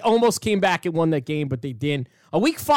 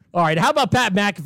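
normal talking voice

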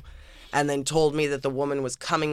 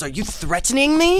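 much higher, louder affronted question; indignant host; 12 semitones higher, 5 dB louder than usual. Retold or imitated. Friend mimicking the host asking about threats, high and affronted. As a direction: act offended.

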